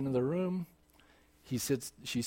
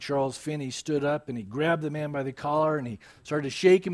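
usual speaking voice

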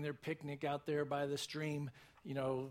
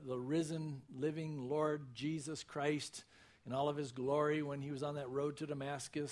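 usual speaking voice

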